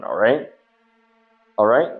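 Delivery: ordinary delivery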